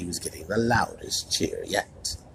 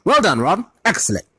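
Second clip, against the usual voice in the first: high, loud, self-important praise; pompous; 12 semitones higher, 7 dB louder than usual. A narrator's reading. Percy congratulates Ron on his sorting, pompously. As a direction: congratulate like a proud prefect. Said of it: "said Percy Weasley pompously".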